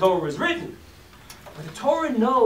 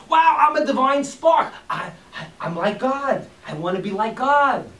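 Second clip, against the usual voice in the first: excited soul; 5 semitones higher, 6 dB louder than usual. Acted.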